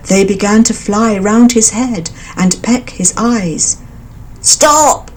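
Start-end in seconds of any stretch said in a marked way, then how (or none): 4.4-5.1 crying out in pain. A narrator's reading. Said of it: "cried the elephant".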